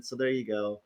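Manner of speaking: usual speaking voice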